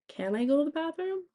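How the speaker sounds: imitated pupil asking. Pupil asking the teacher for the bathroom, high and questioning. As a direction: ask in a high, innocent lilt. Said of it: "to anybody that's like".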